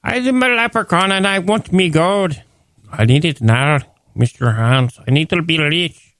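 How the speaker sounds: a hint of a greedy leprechaun. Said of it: "Give me a leprechaun voice".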